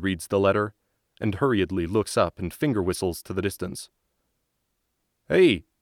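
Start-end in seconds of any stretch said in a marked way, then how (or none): 5.3-5.7 loud, higher call across the yard; shouting. Acted. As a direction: shout to the far soldier. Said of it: "he shouts".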